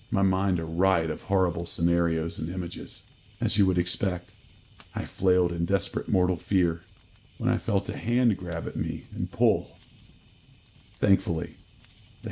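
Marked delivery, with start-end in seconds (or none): none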